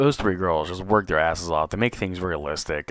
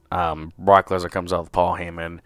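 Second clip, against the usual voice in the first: ordinary delivery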